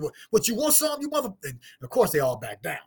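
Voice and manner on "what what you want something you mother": much higher mock challenge; picking a fight, hammed up; retold triggered challenge. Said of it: "I get triggered".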